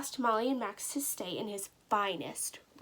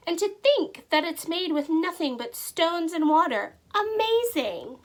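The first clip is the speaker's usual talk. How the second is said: louder and higher, amazed; 6 semitones higher, 6 dB louder than usual. Acted. Mayor amazed at stone soup, loud and high. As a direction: marvel at the soup.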